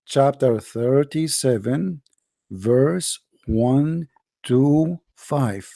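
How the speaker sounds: very slow, word by word; said slowly. Shown as a demonstration. Debater slowly repeats the verse reference to his opponent, very slow and deliberate. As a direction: spell out the reference slowly, each word apart. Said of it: "let me say it slowly".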